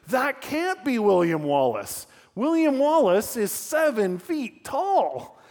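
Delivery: high, slow skeptical shout from the ranks; mock soldier yell. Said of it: "one soldier yells out".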